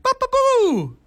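very high, whooping cartoon plumber; squeaky nonsense babble swooping up on the last syllable; newer Mario voice. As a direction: sing out a falsetto cartoon catchphrase. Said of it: "he would talk like".

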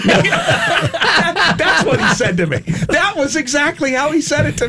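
laughing through the words. Real, not acted. Host laughing as he retells Moses' reply. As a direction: chuckle while telling it. Laughing at his own story.

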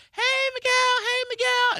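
put-on high childlike cheerful hello; kids greeting. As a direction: play the excited little kids saying hi.

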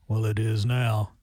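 low, slow deadpan monotone, imitating dad; deadpan dry retort, mimicking father. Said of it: "in his monotone voice goes".

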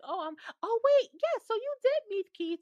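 high, loud, excited realization; eager high-pitched oh wait yes; excited recall. Retold or imitated. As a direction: blurt it out as it suddenly comes back. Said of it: "'cause you was like".

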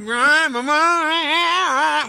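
blaring horn noises with the voice; French horn imitation. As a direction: honk out a bad French horn. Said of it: "played the French horn".

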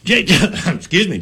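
choking mid-sentence with a laugh; coughing, struggling to get words out. Real, not acted. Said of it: "I'm getting all choked up".

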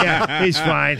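usual speaking voice

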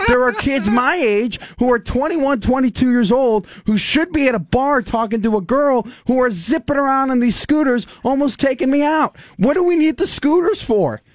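high, heated complaining; worked-up rant. Real, not acted. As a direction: rant about the scooters. Rant about scooters, pitch up.

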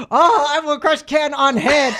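dumb macho brute voice; hammed-up caveman strongman; Gaston voice. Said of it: "Brody Gaston from Beauty and the Beast 'cause he's so macho".

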